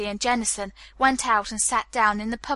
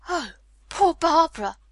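higher-pitched exclamation of sympathy; shocked sympathy, pitch raised; pitying cry; 6 semitones higher than usual. A narrator's reading. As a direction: cry out in sudden pity. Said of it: "she cried".

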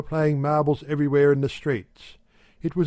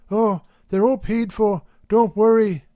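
higher, louder, brushing it off; breezy wife; 8 semitones higher, 5 dB louder than usual. A narrator's reading. Wife reassuring her husband, higher and breezy. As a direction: wave his worry away brightly.

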